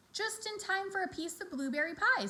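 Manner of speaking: high, welcoming farm wife; Mrs Zuckerman welcoming. A narrator's reading. Mrs Zuckerman greets the children, high and welcoming. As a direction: greet the kids with pie. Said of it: "said Missus Zuckerman".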